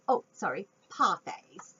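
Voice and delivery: ordinary delivery, nothing marked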